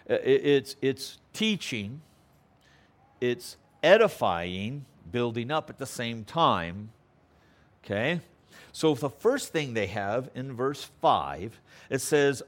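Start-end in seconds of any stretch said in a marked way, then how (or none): none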